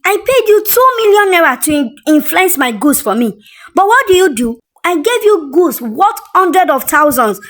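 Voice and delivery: furious accusation. Acted. Brand owner shouting at Tasha in the market, furious. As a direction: tear into her in public, furious. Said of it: "angry brand owner confronting Tasha".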